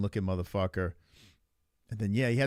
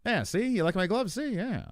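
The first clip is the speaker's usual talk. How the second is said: high, swooping fifties broadcaster voice; Tom Aspinall; 8 semitones higher than usual. Acted. Streamer practising a fighter's voice, high old-time broadcaster impression. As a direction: ham up an old-time newsreel talker. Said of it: "Tom's got that w-, voice".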